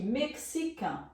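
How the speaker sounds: mexican with X said as "ks", nasal final vowel; French pronunciation. Shown as a demonstration. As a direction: slowly say mexican as "mek-si-KAN", French style. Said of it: "a pronúncia aqui". (mexican à francesa, X com som de "ks")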